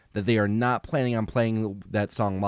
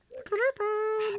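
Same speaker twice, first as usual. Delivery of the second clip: very high, slow falsetto; falsetto singsong; 4 dB quieter, slower than usual. Pretend. Repeats the line, much higher.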